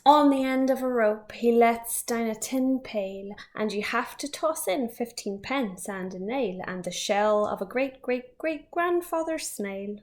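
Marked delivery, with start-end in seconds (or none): none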